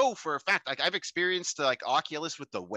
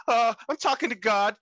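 retold spaced-out guy; 8 semitones higher, 4 dB louder than usual. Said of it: "and he says".

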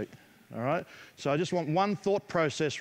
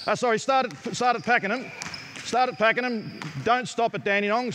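calling out drills; 5 semitones higher than usual. Real, not acted. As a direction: bark the drill so every shooter hears. Calling drills to girls shooting.

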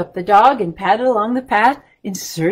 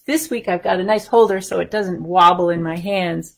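plain reading voice

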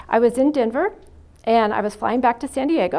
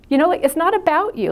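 raised, louder, insistent reproach; exasperated scolding; 6 semitones higher, 5 dB louder than usual. Retold or imitated. Scolding someone who cut in on a crying woman, exasperated. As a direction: scold them with mild retold anger. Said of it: "I just got so mad".

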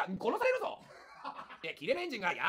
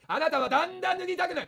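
あなたはだんだん脱ぎたくなる shouted louder, higher, だん stressed; shouted hypnosis; 5 semitones higher, 6 dB louder than usual. Acted. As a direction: hammer the suggestion at full volume. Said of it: "声枯れちゃうぞ。". (声を張り上げる催眠術)

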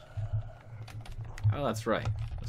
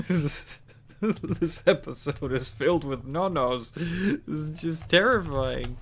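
mock dramatic dismay; 5 semitones higher, 3 dB louder, slower than usual. Gamer hamming up dismay.

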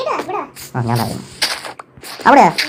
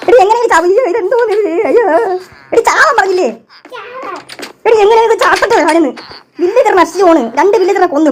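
exasperated scolding; 7 semitones higher than usual. Real, not acted. Scolding teammate for dying again, exasperated high. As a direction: scold her, half laughing.

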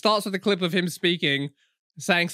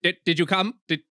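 stumbling repetition. Acted. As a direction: stutter on "Did, did" and rush on. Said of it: "like, stumbles over himself".

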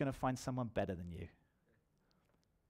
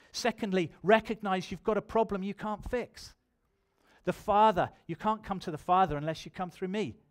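loud, high, emphatic sermon delivery; preacher in full flow, voice raised; full-force preaching; 5 semitones higher, 7 dB louder than usual. Real, not acted. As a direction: press each point home to the congregation.

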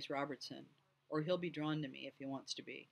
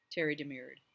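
plain reading voice

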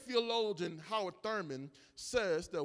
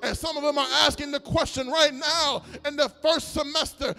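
louder, rhythmic preaching cries over the music; full-force preaching; 5 semitones higher, 10 dB louder than usual. Acted. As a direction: lift the church, call it out.

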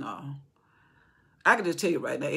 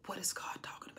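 whispered question; 16 dB quieter than usual. Real, not acted. Preacher to the congregation, whispering the question. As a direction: drop to a whisper mid-sentence.

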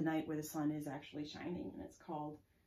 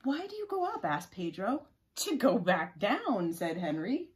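loud, animated question and answer; story read-aloud; 2 semitones higher, 10 dB louder than usual. Acted. Reading Pedro and Henry's exchange to children, animated. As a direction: play up both children's lines as you read.

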